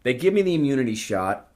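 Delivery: ordinary talk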